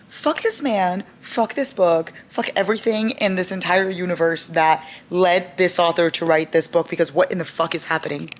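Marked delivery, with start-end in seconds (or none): none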